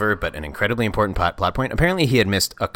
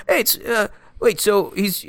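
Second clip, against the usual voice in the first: high, silly put-on British accent; hammed-up British movie-star accent, pitched way up; Hugh Grant accent; 13 semitones higher, slower than usual. Introducing himself to the co-host as the actor, silly British accent. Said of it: "this is me doing a stupid accent".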